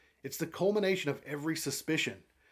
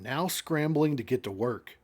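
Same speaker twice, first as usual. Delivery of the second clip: ordinary delivery, nothing marked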